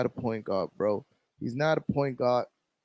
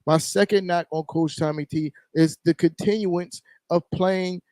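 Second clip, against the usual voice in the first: ordinary delivery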